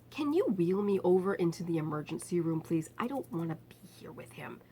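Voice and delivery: soft pleading whisper; whispered plea. Retold or imitated. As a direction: keep it secret from him. Asking the guard discreetly.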